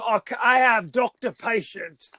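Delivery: put-on lightly done cockney Michael Caine accent; bad Michael Caine accent. Doctor refusing the detective in a mild Michael Caine accent. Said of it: "butchering Michael Caine's accent".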